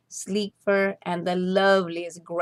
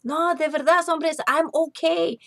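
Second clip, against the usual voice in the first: calm the frightened mice; gentle cat coaxing; reassuring; 8 semitones higher than usual. Acted. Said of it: "Chato reassured".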